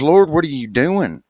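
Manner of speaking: high, loud startled cry; hammed-up alarmed shout. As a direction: yelp it out in shock. Man startled in joke.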